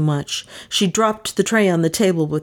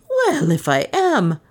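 slow, high-pitched grudging retort; grim unconscious humor; 7 semitones higher, slower than usual. A narrator's reading. Maid answering detective's accusation, grimly humorous. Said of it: "with grim, unconscious humor".

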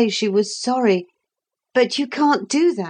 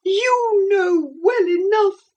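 high, slow and tearfully cross; a mild furious sob; understated angry tears; 12 semitones higher, slower than usual. A narrator's reading. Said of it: "still tearful and furious".

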